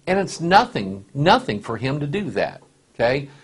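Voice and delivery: ordinary talk, nothing marked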